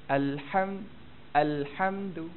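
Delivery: "alhamdu" in careful, measured Quranic articulation; careful Arabic articulation. Shown as a demonstration. Teacher models the word for students, careful Arabic articulation. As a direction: say "alhamdu" with each syllable distinct. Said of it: "hamdu tu pun kena berhati-hati".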